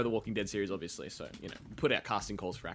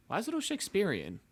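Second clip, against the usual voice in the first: pitched way up, puzzled; high surprised question; 9 semitones higher than usual. Retold or imitated. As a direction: squeak it in disbelief. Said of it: "get into the role".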